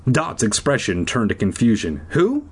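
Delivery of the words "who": higher, folksy character voice; high-pitched confused questioning. Acted. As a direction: put on a high "y'all" character. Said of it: "See, I gave her a character, too".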